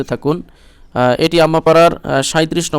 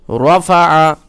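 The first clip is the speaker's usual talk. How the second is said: slow melodic chant with long vowels; Quran recitation; 3 semitones higher, 6 dB louder, much slower than usual. Acted. Teacher recites the verse to show the elongation. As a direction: draw the vowels out in tilawat.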